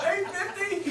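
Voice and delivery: high-pitched, laughing disbelief; incredulous. Retold or imitated. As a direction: squeak the price back in shock. Retold shock at puppy's price.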